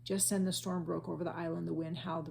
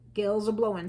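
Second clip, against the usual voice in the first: pirate warning; 3 semitones higher, 10 dB louder than usual. A narrator's reading. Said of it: "said Pinky".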